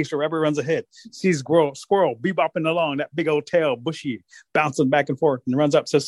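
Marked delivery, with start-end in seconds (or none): none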